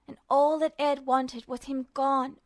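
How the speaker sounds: plain reading voice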